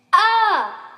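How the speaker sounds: high, loud "Ah!" scream; acted high-pitched scream; children's scream. A narrator's reading. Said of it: "The children screamed more".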